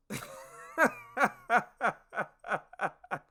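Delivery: long high cackling laugh; real laugh. Real, not acted. Storyteller amused by his buddy's threat, laughing. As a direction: crack up helplessly at your own story.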